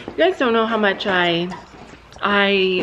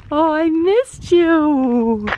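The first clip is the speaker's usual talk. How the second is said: high, slow, tender coo; cooing to a horse; 7 semitones higher, 4 dB louder, much slower than usual. Real, not acted. Reunion with her horse.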